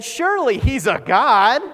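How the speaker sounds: loud, high mock conviction; big sarcastic jeer; sarcastic taunt. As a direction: proclaim it as if you don't mean a word. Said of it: "He's being sarcastic here".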